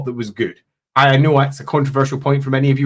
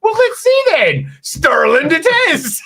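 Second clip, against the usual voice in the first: high, drawn-out teasing delivery; hammed-up, high-pitched relish; gleeful mock decision; 13 semitones higher, slower than usual. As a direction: hand down a playful punishment in a high, slow voice. Acting out his teasing game-master decision.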